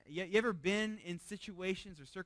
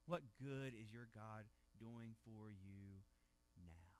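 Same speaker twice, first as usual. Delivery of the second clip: much softer, slower taunt; hushed mocking question; 7 semitones lower, 12 dB quieter, slower than usual. Preacher quietly echoing the mocker to his listeners, very soft. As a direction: let the taunt sink to almost nothing.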